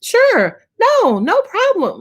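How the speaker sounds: high, sugary phone-agent yes; customer service voice. Retold or imitated. Psychic imitating her old phone-line self to a caller, high and chirpy. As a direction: chirp it like a call-center worker hiding fatigue. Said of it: "I was always on that line".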